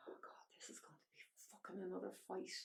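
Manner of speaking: hushed, breathless whisper of dread; whispered thought. Retold or imitated. Remembering her dread at the news, whispered to herself. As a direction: whisper it like a thought you can't say aloud.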